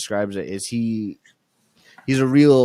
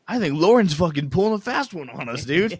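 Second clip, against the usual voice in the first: mock whiny roommate; 7 semitones higher than usual. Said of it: "I hope he turns and goes".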